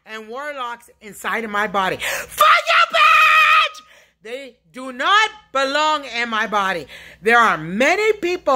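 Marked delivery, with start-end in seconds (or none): 2.0-3.8 raw screaming outburst. Real, not acted. Lashing out at unseen tormentors, shrieking. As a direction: shriek the curse out at full force. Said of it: "This yelling and screaming".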